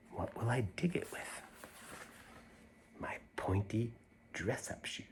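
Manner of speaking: unvoiced, secretive whisper. The girl whispers her secret tunnel plan to the listeners. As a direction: whisper it like a conspirator.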